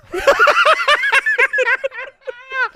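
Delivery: loud, high howl of laughter; cackling at the Pompeii pick; big burst of laughter. Real, not acted. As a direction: crack up out loud.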